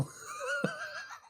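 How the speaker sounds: high, soft giggle; amused laugh. Real, not acted. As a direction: laugh lightly at your own understatement. Amused by own euphemism oopsie doodles.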